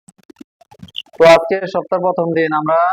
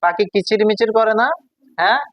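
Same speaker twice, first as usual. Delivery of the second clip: usual speaking voice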